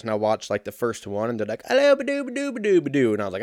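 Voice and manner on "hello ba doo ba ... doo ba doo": high-pitched British gibberish, sliding down at the end; hammed-up, nonsense British accent way up high; mock British accent. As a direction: mimic unintelligible British speech as babble. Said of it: "they have a British accent".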